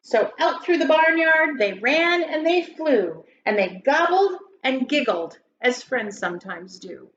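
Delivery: lilting rhymed storybook read-aloud; story narration. Acted. Teacher reading a Thanksgiving poem to grade schoolers, lilting. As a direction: read it like a bedtime poem for kids.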